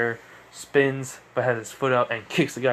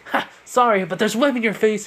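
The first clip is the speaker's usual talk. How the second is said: high, louder teasing quip; mock apology, pitched up; cheeky taunt; 9 semitones higher, 5 dB louder than usual. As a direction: crow over the webbed thug. Hero taunts webbed thug.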